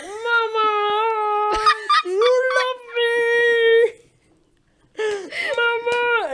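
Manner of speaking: high, loud, long-drawn cry for mum; drawn-out mock cry on gas and air; hammed-up labour wail. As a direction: wail it out, stretching the vowels. Retelling cries to mother in labour.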